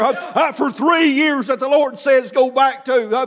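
chanted preaching cadence gathering force; cadenced preaching. Real, not acted. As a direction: preach in rhythmic bursts with gasped "uh"s, climbing to a shout. Preacher's cadenced sermon, building in force.

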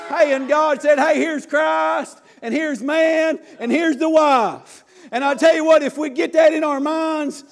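loud, high preacher's exhortation; full-force revival sermon; fiery preaching. Acted. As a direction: preach it hard to the congregation.

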